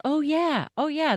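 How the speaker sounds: high-pitched "it clicks now" reaction; light, high voice of dawning recognition; mimicked realization. Voicing listeners' reaction of recognition.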